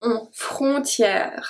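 frontière drawn out slowly, nasal on sound stressed; slow pronunciation demo. Shown as a demonstration. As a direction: stretch on, frontière for the class. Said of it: "O N make the sound on".

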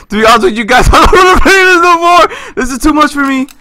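loud, high-pitched panicked outburst; panicked protest. Real, not acted. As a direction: beg to quit at the top of your voice. Panicked refusal to keep playing.

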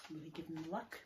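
put-on lively read-aloud for small children; storybook reading. A narrator's reading. Teacher reading a leprechaun story to the class, lively. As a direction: read the tale brightly to the class. Reads a picture book aloud.

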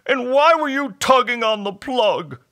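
high, loud weepy creature voice; tearful, hammed-up thanks; sniffling hobgoblin. A narrator's reading. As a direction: say it through mock sniffles. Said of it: "sniffed the hobgoblin".

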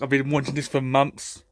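ordinary talk, nothing marked

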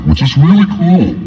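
ordinary talk, nothing marked

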